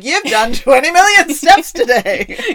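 high, loud, drawn-out cheer; mock celebration. Fitbit congratulating its traumatized wearer, loud mock cheer. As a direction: cheer it like an overexcited gadget.

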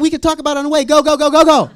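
loud, high frantic hurrying; rising-volume repeated urging; urgent order. Retold or imitated. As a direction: shoo them away in a panic. Acts rushing friends into car.

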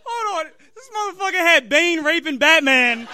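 high, loud disbelieving outburst; incredulous laughing shout. Real, not acted. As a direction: burst out in shocked laughter. Breaks character, shouting.

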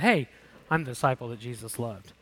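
a lightly voiced, smug beloved disciple; smug John. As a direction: tease John as a bit self-satisfied. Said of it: "it's just funny when he says it".

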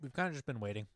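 chewing while talking; crunching tots. Real, not acted. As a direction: talk through a mouthful. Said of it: "Sorry, I'm crunching on the last little bits of my tots".

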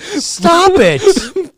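high, loud disbelief; shocked outburst. Real, not acted. Host hearing about the yearbook page, high loud disbelief. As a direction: exclaim it, scandalized and amused.

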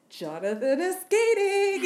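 high, loud, drawn-out announcement; excited calling out. Acted. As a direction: sing it out over a cubicle wall. Coworker calling over cubicle wall, imitated.

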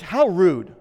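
loud, high scandalized how rude; shocked, huffy voice; indignant woman. Retold or imitated. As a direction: play the offended lady scolding him. Retold rude woman scolding.

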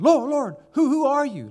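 high, loud cry upward; yelling up to the light. Acted. Paul on the ground yelling up at the light. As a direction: call up from the ground, desperate. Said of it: "he yells up into the light".

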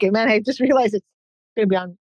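ordinary talk

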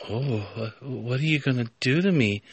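soft, acted question; hushed apprehension. Cat girl asks the professor, hushed acted question.

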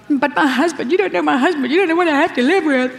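high, put-upon whine; mock whining. Preacher imitates a believer whining about her husband, mock whine. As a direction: complain in a self-pitying moan. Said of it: "and you whining".

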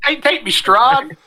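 high, slow swooning parody; mock plea. Riffing on the quote with the villain's name, mock plea. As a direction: pitch it high and draw it out, hammed-up. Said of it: "Marcy was yelling about".

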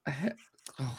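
ordinary talk

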